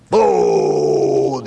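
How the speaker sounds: loud drawn-out call for food; gut yelling. Acted. The old gut yelling for food. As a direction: yell it like a hungry belly. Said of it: "the old gut's yelling".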